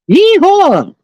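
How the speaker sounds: high hammed-up cowboy whoop; high yeehaw cowboy call; mock hillbilly yeehaw. Redneck mashup joke.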